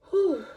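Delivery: loud, high exhaled whew; high whew of breathlessness; winded whew. Real, not acted. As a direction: blow out a big whew to catch your breath. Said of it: "I'm out of breath".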